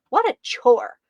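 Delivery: usual speaking voice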